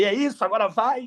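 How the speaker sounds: celebrating a first sale, voice up high; a jubilant "this is it"; retold shout of joy; excited cheer.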